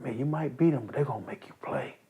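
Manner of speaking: soft, confiding rumour; hushed. Retold or imitated. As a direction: pass it on like gossip. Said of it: "people start saying".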